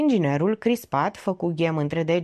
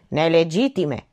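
usual speaking voice

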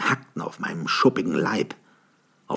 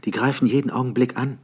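urgent warning; 7 semitones higher, 3 dB louder, faster than usual. A narrator's reading.

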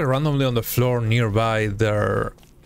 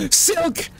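high delighted cry of "Silk!"; excited shout. Real, not acted. Streamer spotting silk, squealing excitedly. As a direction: yelp it as a sudden find.

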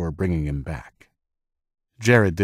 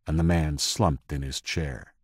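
usual speaking voice

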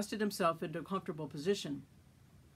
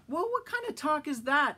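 higher, irritated retort; annoyed; 8 semitones higher, 4 dB louder than usual. A narrator's reading. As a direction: snap back, offended. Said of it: "Adam sounded annoyed".

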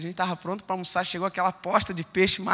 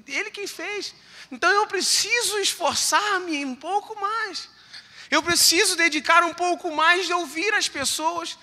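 fervent preaching; 8 semitones higher, 6 dB louder than usual. Acted. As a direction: drive the message home from the pulpit. Preaching at full force. (pregação fervorosa, mais aguda e lenta)

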